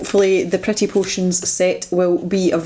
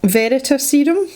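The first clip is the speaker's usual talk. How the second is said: ordinary delivery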